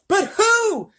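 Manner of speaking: high, loud yelled question; yelling. A narrator's reading. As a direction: yell it as the frantic president. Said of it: "yelled the president".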